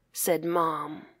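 plain reading voice

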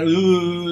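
high, loud imitated bawl; mock crying wail. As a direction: wail like a crying kid, playfully exaggerated. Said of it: "Right in the middle of crying".

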